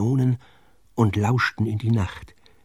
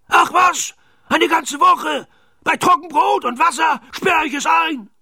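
high, raging captain's voice; Knurrhahn bawling. A narrator's reading. Pirate captain raises the pig's punishment, bawling. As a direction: bawl it out very high, escalating the threat. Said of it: "gröhlte Knurrhahn".

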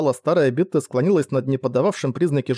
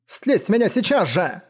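higher, strained command; croaked order; 6 semitones higher than usual. A narrator's reading. Woman pinned down orders the slave off her, croaking. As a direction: choke out an urgent demand. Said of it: "прохрипела она".

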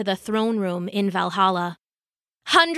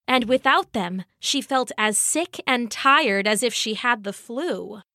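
ordinary delivery

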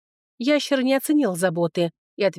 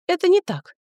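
high, louder protest; disagreeing; 11 semitones higher, 5 dB louder than usual. A narrator's reading. Young woman contradicting the dwarf, protesting. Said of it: "не согласилась я".

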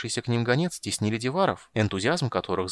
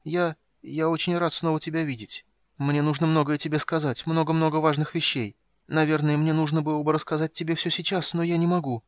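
weak kender voice; 6 semitones higher than usual. Acted. Wounded kender near fainting.

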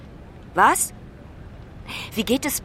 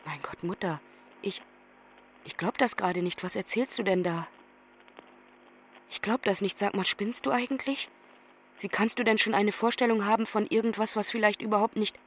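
stunned disbelief; 2 semitones lower, 7 dB quieter than usual. Acted. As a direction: voice drops soft as the bad news sinks in. Daughter hears of mother's illness.

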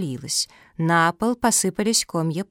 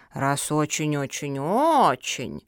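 slow, low start, swelling last word; drawn-out pondering; 5 semitones lower, slower than usual. A narrator's reading. Turtle weighing the pugs' plea, drawling. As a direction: drawl the repeats, stretching the last. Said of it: "протянула черепаха".

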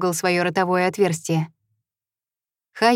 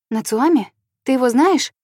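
startled eager question; 5 semitones higher than usual. Acted. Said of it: "встрепенулась Хайя".

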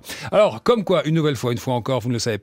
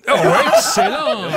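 much higher exclamation with a laugh; mock delighted cry; 6 dB louder than usual. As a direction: burst out, tickled by the answer. Personnage de jeu parodique s'exclame. (exclamation ravie, beaucoup plus aiguë, en riant)

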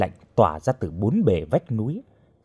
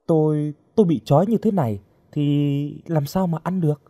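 lightly voiced girl, higher pitch; hesitant, higher-voiced protest; captive girl; 5 semitones higher than usual. A narrator's reading. Bound girl complaining to her guard, lightly pleading.

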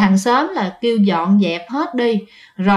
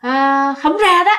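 defiant ghost; 5 semitones higher, slower than usual. Retold or imitated. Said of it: "bà cười lên".

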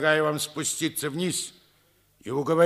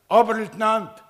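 sharp, loud summons pitched higher; calling out; 5 semitones higher, 8 dB louder than usual. Acted. Colonel summoning his first lieutenant, loud commanding call. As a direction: bark the officer's rank across the ruins.

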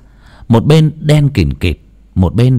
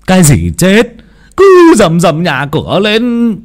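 higher, louder, slow scolding; husband scolds wife for the uproar, higher and louder; irritated scolding; 9 semitones higher, 5 dB louder than usual. Acted. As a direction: tell her off for making a racket. Husband scolds wife's fuss.

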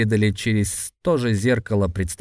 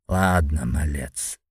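put-on low amused old man's voice; a sly grin in an old voice; grinning old man; 7 semitones lower, slower than usual. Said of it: "оскалился старец". (старик с оскалом)

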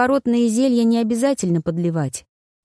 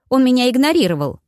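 higher-pitched offended protest; hurt; 6 semitones higher, 4 dB louder than usual. A narrator's reading. Woman complaining that she was ignored, hurt. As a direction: complain high, wounded by the slight. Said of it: "обиженно заявила Хелинда".

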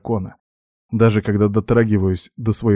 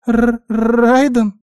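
high, slow stutter on the name; hiccuping guinea pig; 13 semitones higher, 4 dB louder, much slower than usual. A narrator's reading. Guinea pig stunned by who he is, stammering squeak. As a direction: squeak it haltingly, stuck on the first sound. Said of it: "икнула Винни".

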